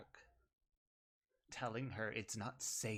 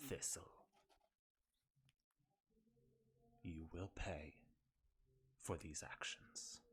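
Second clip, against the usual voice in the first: quiet, bitter villain speech; 2 semitones lower, 3 dB quieter than usual. Acted.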